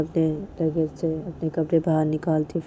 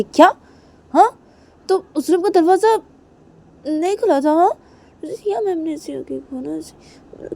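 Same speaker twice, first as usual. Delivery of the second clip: much higher, louder outrage; outraged Kajal; 7 semitones higher, 6 dB louder than usual. Acted. Kajal hears about the locked room, high shocked anger. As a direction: explode in disbelief.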